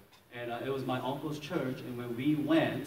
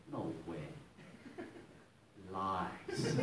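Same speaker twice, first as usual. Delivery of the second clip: low, soft scoffing; quiet, deep-pitched dismissal; skeptical disbelief; 7 semitones lower, 12 dB quieter, much slower than usual. Retold or imitated. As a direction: mutter it low in cynical disbelief. Cynic silently disbelieving testimonies.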